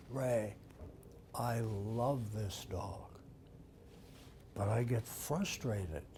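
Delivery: low, slow, softened admission; quiet confession. Retold or imitated. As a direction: confide it softly, taking your time. Husband leans close, confessing.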